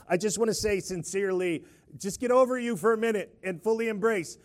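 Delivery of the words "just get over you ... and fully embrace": normal talking voice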